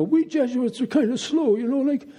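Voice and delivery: high-pitched imitation of an old Jesuit priest; Mister Donnelly. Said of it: "he was quick to say".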